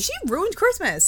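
mock outrage. Real, not acted. Repeating friend's line in outrage.

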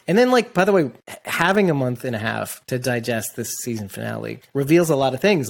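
ordinary talk

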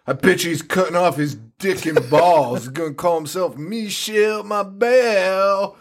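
jeering stepdad imitation, rising in pitch; put-on mocking voice, slowing and climbing at the end. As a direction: imitate a taunting stepdad, stretching out the name. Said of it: "the dad's like".

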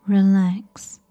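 usual speaking voice

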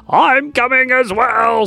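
a bear bounding in, keen to join; lightly voiced bear. The bear joins in.

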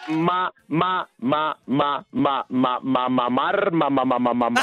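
low repeated "ma, ma"; comic stuck-syllable stammer, dropping low; stuttered "ma". Pretend. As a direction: sputter "ma" like a stalling engine. Caller's comic stuttered syllable bit.

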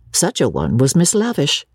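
ordinary delivery, nothing marked

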